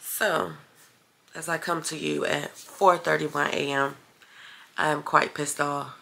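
low and soft so as not to wake the house; hushed late-night talk. Real, not acted. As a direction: keep it down, it's four in the morning. Recording at 4:31 AM.

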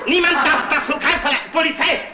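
high-pitched, frantic commands; panicked alarm. Acted. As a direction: shout orders in a panic over a theft. Money bag gone, raises alarm. (panisch, mit hoher Stimme Befehle rufend)

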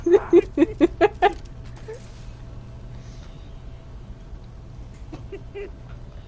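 long cackling laugh; cartoon laugh. Real, not acted. Big laugh after a win.

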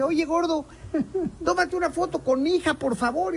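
a touch of a sugary mom; lady asking. Retold or imitated. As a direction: mimic a polite mother asking for a photo. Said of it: "Llega una señora con su niña y dice".

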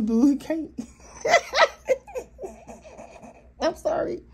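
helpless laughter breaking up the words, pitch climbing high; real laughing fit. Real, not acted. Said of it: "why I'm laughing".

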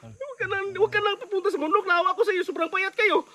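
pleading with Commander Gine, mock tearful; mock crying plea. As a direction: beg her not to go back, near tears. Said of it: "Nakakaiyak talaga".

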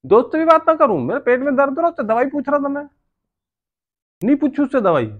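put-upon husband protesting high; indignant protest. Pretend. Protesting in a staged couple quarrel.

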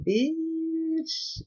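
high, sassy diva exclamation. Retold or imitated. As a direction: boss up, full of attitude. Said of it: "boss up and tell a motherfucker".

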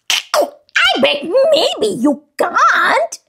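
high, slow teasing sing-song; teasing monkey voice. Acted. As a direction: tease like a cheeky monkey.

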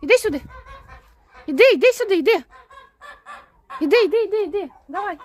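high singsong calling out; calling the goose. Real, not acted. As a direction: call her over, high and carrying. (висока співуча підкликальна інтонація)

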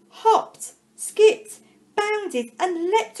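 high, loud, springy words; bouncy emphasis. A narrator's reading. Narrator reading to children bounces on each word, high and loud. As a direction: make each word hop.

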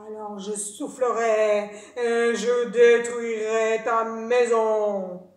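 loud, very slow threat; big bad wolf warning; menacing wolf. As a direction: drag out every word, big and heavy. Wolf threatens third pig.

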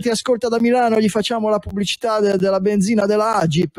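high, fast, eager sales pitch; pushy ad agency salesman. Retold or imitated. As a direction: gush like an overexcited ad salesman. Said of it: "l'agenzia arriva subito e dice".

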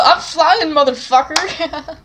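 half-yelled cheer, drawn out at the end; mock yell. Imagining riding a flying bike with a friend, playful mock yell. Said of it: "then we could yell".

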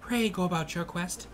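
game narration. Acted. Player reading out the quest text, lofty game narration. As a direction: read it high, as a quest-giving narrator. Reads the game's scene text.

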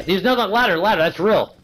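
excited. Real, not acted. Frantic callout mid-fight.

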